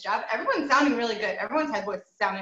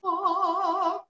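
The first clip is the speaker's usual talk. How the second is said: higher open yawning ah; the right, raised-palate vowel; yawny ah; 8 semitones higher than usual. Shown as a demonstration. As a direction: classical open ah.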